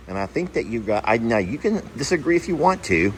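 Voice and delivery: ordinary delivery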